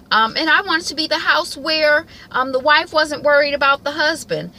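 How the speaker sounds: high, loud, pleading Randall; high-pitched Randall impression. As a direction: voice him high and loud, like an eager kid. Voicing Randall's wish list.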